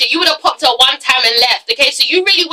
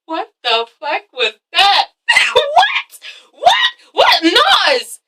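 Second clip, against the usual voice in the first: high shrieking disbelief with a laugh; shocked shriek; 7 semitones higher, much slower than usual. Real, not acted. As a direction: squeal What? over and over, stunned. Shocked by vomiting on show.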